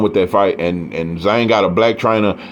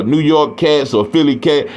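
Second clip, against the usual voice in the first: normal talking voice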